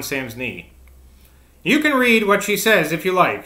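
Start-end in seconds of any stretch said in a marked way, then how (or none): 1.6-3.5 raised, higher-pitched outcry; cried out. A narrator's reading. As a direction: call it out, pitched up. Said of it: "she cried".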